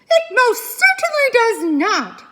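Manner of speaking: high, loud indignant holler. Acted. Mother beaver hollers in agreement with her husband. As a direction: holler it, backing your husband. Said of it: "hollered Missus Beaver".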